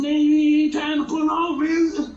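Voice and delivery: hollering the friend's line, mock outrage; retold shout. Retelling the dude's complaint to a friend, a loud mock holler. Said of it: "he said".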